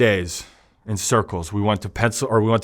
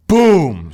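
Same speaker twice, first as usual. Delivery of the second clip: door slam sound; 5 semitones higher, 7 dB louder than usual. Retold or imitated. Door slammed in his face.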